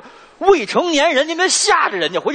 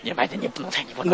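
softer whispered warning; urgent whisper; 3 semitones lower, 7 dB quieter, much faster than usual. Real, not acted. Warning his partner off the throne, whispered aside. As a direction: hiss it under your breath.

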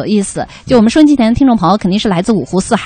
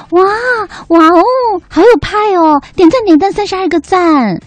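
gushing excited; 7 semitones higher than usual. Retold or imitated. Reads out a fan's gushing comment.

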